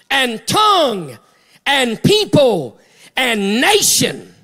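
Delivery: shouted, drawn-out preaching with held last words; fervent preaching. Real, not acted. Preacher proclaims redemption by the blood to the church, fervent preaching. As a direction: stretch the key words high and loud.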